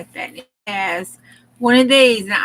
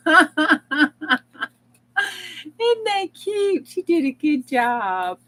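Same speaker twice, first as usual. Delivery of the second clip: high and louder, laughing through it; laughing delight; 7 semitones higher, 4 dB louder than usual. Real, not acted. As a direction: squeal it while laughing. Laughing at bunny card.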